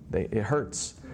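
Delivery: usual speaking voice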